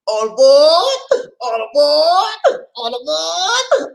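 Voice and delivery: long, high, loud drawn-out call; conductor's call. Pretend. Calling everyone onto the train, long high conductor's shout.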